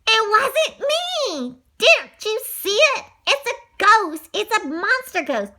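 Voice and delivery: high, drawn-out insistence; frantic protest. A narrator's reading. Accused boy telling kids about the ghost, frantic protest. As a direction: plead to be believed, high and loud.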